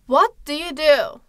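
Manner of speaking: slow model question. Shown as a demonstration. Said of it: "nasıl soruyorum".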